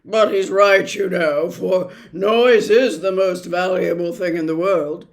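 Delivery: loud, emphatic doctor voice; bombastic doctor. Acted. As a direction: proclaim it like a great truth.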